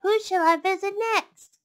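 high, loud storybook voice; a lively animal character wondering aloud. Acted. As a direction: ask it brightly in character.

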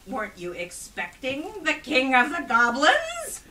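slow build to a huge monster roar; rising roar. A narrator's reading. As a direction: swell into a hurricane roar. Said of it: "The voice rose to a hurricane roar".

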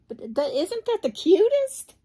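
high, loud gush; excited. Real, not acted. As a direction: squeal over how cute it is. Gushing over outfit.

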